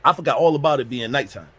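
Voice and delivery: usual speaking voice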